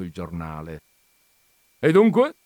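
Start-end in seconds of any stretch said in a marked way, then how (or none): 1.8-2.3 high, loud, commanding exclamation; peremptory. A narrator's reading. Visitor confronting the countess with her article, peremptory. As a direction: allow no possible reply. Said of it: "con un tono che non ammetteva repliche".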